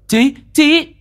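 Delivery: high, loud shout of a name. A narrator's reading. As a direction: yell the boy's name in alarm. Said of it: "cũng la toáng lên". (hét to gọi tên)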